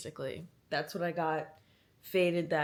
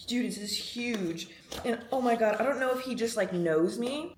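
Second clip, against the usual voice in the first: ordinary delivery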